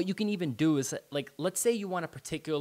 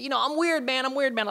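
playful quirky; 11 semitones higher than usual. Acted. Playful self-mocking aside.

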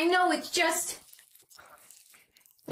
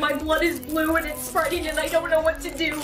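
panicked; 5 dB louder than usual. Acted. Blood turning blue, panic.

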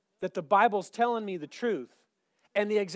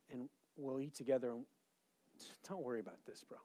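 soft, low, casual coaxing; quiet, laid-back reassurance; unbothered God; 3 semitones lower, 11 dB quieter than usual. Acted. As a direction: murmur it calmly, like a relaxed friend inviting someone over. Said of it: "Here's God".

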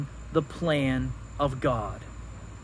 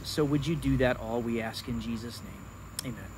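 low, subdued praying voice; prayer; 4 semitones lower, 4 dB quieter than usual. Real, not acted. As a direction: pray softly, winding down to a hushed close. Closing prayer before the reading.

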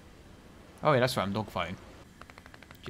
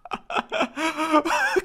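high-pitched disbelieving laugh. Real, not acted. Laughing at the failed spin.

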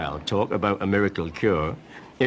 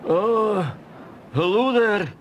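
higher, hammed-up feeble greeting; feebly; 10 semitones higher, slower than usual. Cromarty greeting the keeper feebly, faking illness. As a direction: sound weak but not too weak. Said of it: "said Cromarty feebly".

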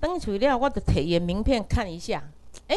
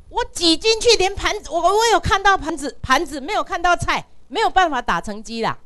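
retold protest; 7 semitones higher, 6 dB louder than usual. Retelling her protest, agitated.

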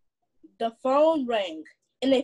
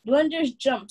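ordinary delivery, nothing marked

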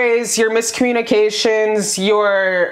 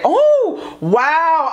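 startled little oh; surprised gasp; 5 semitones higher than usual. Real, not acted. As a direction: gasp at what you see. Sudden sight of the card.

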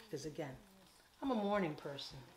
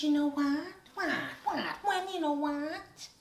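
high, loud sassy retort; exasperated sass; 9 semitones higher, 9 dB louder than usual. Retold or imitated. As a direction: snap back at her own reflection. Quoting her own exasperated outburst.

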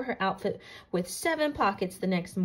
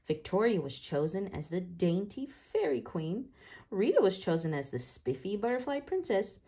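ordinary delivery

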